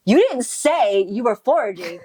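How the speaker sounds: mock indignant disbelief; shocked and angry. He confronts her about foraging, shocked and angry. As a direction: play surprised and cross at the news. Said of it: "shocked and surprised and angry".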